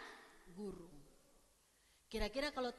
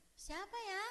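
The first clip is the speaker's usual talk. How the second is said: higher-pitched, unsure question; puzzled; 8 semitones higher, slower than usual. Acted. Maria failing to recognise who calls her, puzzled. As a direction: ask it as if the caller is a stranger. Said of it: "dia nggak bilang gini".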